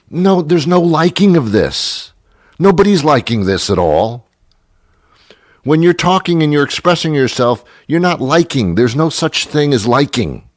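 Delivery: high, indignant scolding; exasperated lecture. Acted. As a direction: lay down the grammar rule, fed up. Rants against saying "like.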